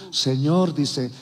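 ordinary talk

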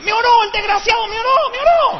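comic indignant shriek; mock outrage. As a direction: squeal it like you were robbed. Outraged he only got prayer.

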